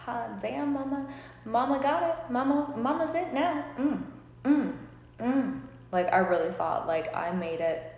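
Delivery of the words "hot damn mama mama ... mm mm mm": high, sassy hype-up; impressed. Retold or imitated. Pulling up to a mansion, sassy and impressed. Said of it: "And I'm just like".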